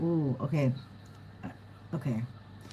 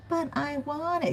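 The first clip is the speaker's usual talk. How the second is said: whiny; 8 semitones higher, 4 dB quieter than usual. Pretend. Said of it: "And they're like".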